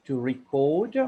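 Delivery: to RECORD as ree-CORD; verb pronunciation. Shown as a demonstration. Grammarian teaching the verb, record said ree-CORD. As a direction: stress the second syllable of record. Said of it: "as a verb".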